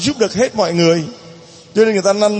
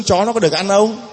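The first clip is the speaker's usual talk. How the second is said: ordinary delivery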